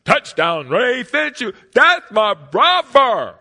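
booming high announcer call, stretched out; stadium announcer. Retold or imitated. Boy recalling the announcer calling his brother's name, booming. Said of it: "I hear the announcer say".

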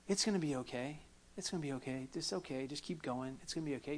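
hushed, imitated soothing self-talk; soft reassurance. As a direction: softly mimic people brushing the pain away. Voicing hollow everyday reassurance.

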